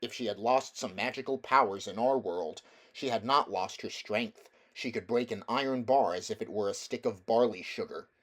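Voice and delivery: usual speaking voice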